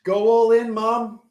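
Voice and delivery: real yell to his mother; real shout. Real, not acted. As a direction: shout across the house to mum. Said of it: "I forgot to mute the, mute the mic".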